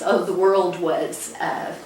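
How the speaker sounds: normal talking voice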